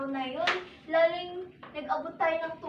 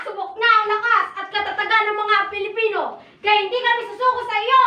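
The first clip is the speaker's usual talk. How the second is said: defiant shout; 4 semitones higher, 8 dB louder, faster than usual. Acted. Family defies the virus.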